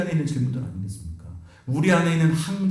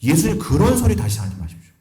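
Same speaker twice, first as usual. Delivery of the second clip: sharp, raised rebuke of his master; scolding Peter; 5 semitones higher, 4 dB louder than usual. Retold or imitated. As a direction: tell him off, higher and louder. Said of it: "예수님을 야단친 겁니다".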